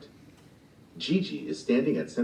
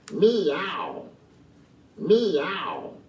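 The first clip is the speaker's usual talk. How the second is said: higher mock meowing, tired; cat meow; 9 semitones higher than usual. Cat rubbing its sore feet, meowing. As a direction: complain like a footsore cat.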